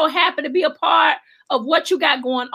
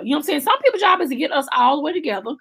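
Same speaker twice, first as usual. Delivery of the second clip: ordinary delivery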